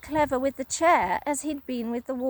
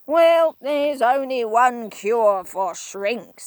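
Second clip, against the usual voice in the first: slow, louder sly tease; Mister Twit, teasing; 7 dB louder, slower than usual. Acted. Husband teasing his wife, sly slow. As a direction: dangle the cure slowly. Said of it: "said Mister Twit".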